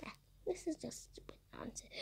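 fast, breathless whispered complaint; whispered grumble. Real, not acted. Gamer grumbling at the game in a fast whisper. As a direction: mutter it under your breath, annoyed.

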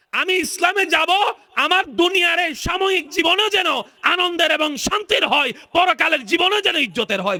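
very high, chanted sermon wail; full-force preaching. Acted. Preacher to the crowd on Islam as cure, high sung wail. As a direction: cry it out in a long sung preaching tone.

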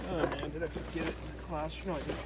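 low, soft aside to himself; muttering to self. Real, not acted. Instructor checking to himself whether he forgot something, muttered low. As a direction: drop to a private mumble while searching.

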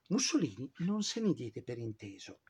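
ordinary talk

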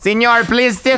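high, slow, polite mock-Spanish begging; mock Spanish plea. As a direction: coax the dog in a hammed-up Spanish accent. Pleading with the Mexican dog.

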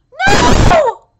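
long, loud high "no"; dismayed scream. Real, not acted. Reader dismayed at a character's fate, dismayed scream. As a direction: scream it in protest.

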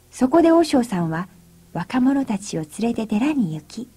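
ordinary delivery

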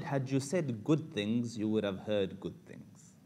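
slow, weighed delivery of the mom's advice; mother gently teaching her son, slow and deliberate; slow wise advice. Shown as a demonstration. Slow measured moral. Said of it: "Can I say that again?".

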